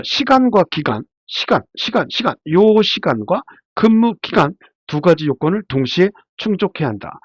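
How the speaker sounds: ordinary talk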